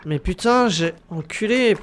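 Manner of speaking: ordinary talk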